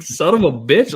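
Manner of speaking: retelling a gate mishap, laughing exasperation; mock exasperated curse. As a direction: blurt it out in half-amused frustration. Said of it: "And I'm like".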